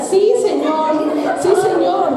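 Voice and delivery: slow, drawn-out mock advert catchphrase; advert imitation. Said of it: "Ya parecemos como la propaganda". (imitando una propaganda, alargado y más agudo)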